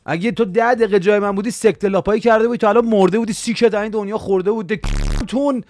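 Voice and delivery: loud, furious yelling at a viewer; outraged shouting rant; angry rant. Acted. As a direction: tear into him, shouting. Streamer yelling back at viewer.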